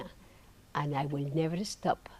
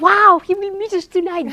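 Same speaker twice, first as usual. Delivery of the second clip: much higher and louder, bursting with joy; jumping for joy; 12 semitones higher, 12 dB louder than usual. Retold or imitated. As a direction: heart shouting with delight. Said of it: "jumping, happy".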